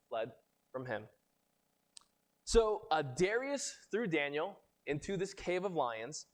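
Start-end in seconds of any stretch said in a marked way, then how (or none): none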